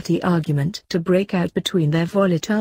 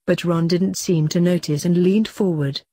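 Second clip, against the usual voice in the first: ordinary delivery, nothing marked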